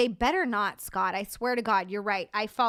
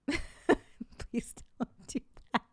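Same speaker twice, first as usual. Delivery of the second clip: laughing; much slower than usual. Real, not acted. Giggling at own silliness.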